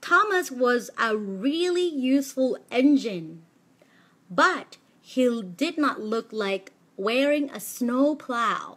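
storybook read with deliberate pauses at punctuation; pausing at commas. Shown as a demonstration. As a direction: read slowly with a clear stop at each comma. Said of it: "ครูหยุดนะ".

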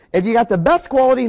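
usual speaking voice

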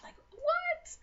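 very high squeaked what; hammed-up shocked squeal. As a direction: shriek it in mock disbelief. Nicole's shocked reaction, retold.

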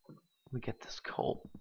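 whispered, almost no voice. Real, not acted. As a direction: sneak the words out in a whisper. Sneaking for coal quietly.

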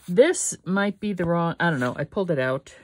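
ordinary talk, nothing marked

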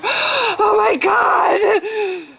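high gasping shout; hammed-up horrified gasp; mock gasp of horror. Mock horror at her face.

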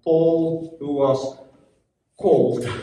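slow, with a pause before the last word; deliberate, drawn-out reading. Shown as a demonstration. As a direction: let each word of scripture land. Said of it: "the slower you read it".

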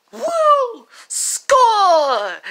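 loud, high victory shout; whooping celebration, pitched right up; triumphant whoop. Acted. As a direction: crow loudly over scoring. Celebrating a point over Luigi.

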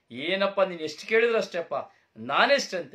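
loud, high and fast, cocky son bragging; boastful shout. Acted. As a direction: shout it like a proud son showing off. Son boasting loudly to his father. (ಅಪ್ಪನ ಮುಂದೆ ಜಂಬದಿಂದ ಕೂಗುವ ಮಗ)

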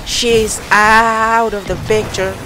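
slow mock-dramatic villain line. Gamer doing a slow dramatic movie line. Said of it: "Why do I have the worst voice ever".

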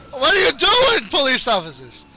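very high, loud protest; exasperated yell. Real, not acted. Gamer protesting to police officers, exasperated high yell. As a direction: shriek it in disbelief.

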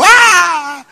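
long, very high, loud bawl; exaggerated comic sobbing howl; mock crying wail. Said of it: "más llorando". (berrido de llanto fingido)

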